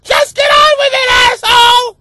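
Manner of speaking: very high, loud screech; impatient mock shout. Impatient listener heckling the host, high mock screaming.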